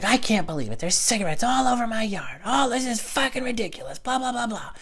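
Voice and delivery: high-pitched screechy complaining impression; mock screaming neighbor. Neighbor complaining to her friend about cigarettes, mock screaming. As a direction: imitate a neighbor ranting way up high. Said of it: "she's screaming it loud enough".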